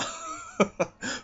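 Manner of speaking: squeaky burst of laughter; high giggle. Real, not acted. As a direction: giggle helplessly. Breaks up mid-line.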